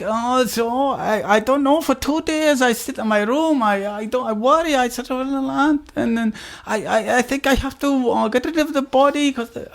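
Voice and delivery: hesitant Swedish cellmate; mock Swedish accent. Cellmate explains his two days in mock Swedish accent. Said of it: "It's like".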